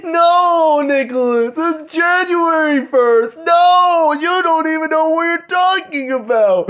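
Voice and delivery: high, loud whiny mock outrage; mocking upset critics. Mocking people who insist on January first, in a high mock shout. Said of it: "everyone will probably get upset saying".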